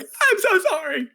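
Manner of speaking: high, squeaky fake-sorry voice, laughing; a goofy high voice through laughter; mock apology. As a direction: ham up a timid apology. Gang members apologize, mocked.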